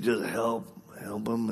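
lightly voiced dad, soft, subdued plea; imitating praying dad. Dad asking God to help his boy, lightly imitated. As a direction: trail away softly like dad mid-prayer. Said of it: "Dad, pray for me".

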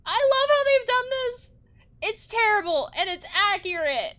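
high, loud delighted exclaim; excited. Real, not acted. Player gushing over the game's design. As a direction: gush about it.

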